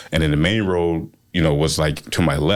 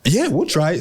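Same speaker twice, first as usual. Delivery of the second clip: eager agreement; 10 semitones higher than usual. Retold or imitated. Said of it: "we're like".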